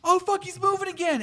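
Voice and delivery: put-on high-pitched nervous kid; hammed-up high fretting; mock panic. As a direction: imitate kids on edge. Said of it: "they're all like".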